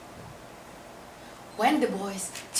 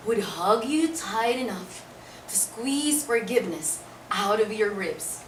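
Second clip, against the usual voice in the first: usual speaking voice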